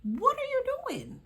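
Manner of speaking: high incredulous squeal at the fighters. Retold or imitated. Watching the girls fight, high incredulous squeal. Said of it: "And I'm just like".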